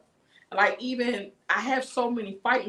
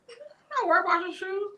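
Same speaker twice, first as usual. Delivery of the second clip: put-on dismissive girl; 12 semitones higher than usual. Dismissive girl voiced in story.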